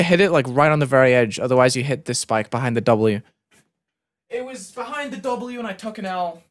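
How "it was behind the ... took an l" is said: small, high, soft voice; sulky whine. Real, not acted. Player owning up to taking the loss, small sulky whine. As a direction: whine it quietly in defeat. Sulking after the death.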